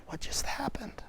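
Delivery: whispered, bewildered; hushed disbelief; stunned whisper. Retold or imitated. Man waking after the vision, stunned whisper.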